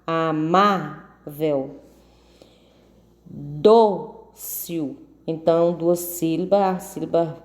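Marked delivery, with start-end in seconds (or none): 0.1-1.8 "amável" very slow, a-MA-vel; slow sounding-out of "amável", stress on MA; stressed middle syllable. Shown as a demonstration. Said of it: "A sílaba tônica é o ma". 3.3-5.0 "Dócil" in two separate beats, DÓ held; teacher's split "Dócil", stress on DÓ; stressed first syllable. Shown as a demonstration. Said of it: "forte é o dó".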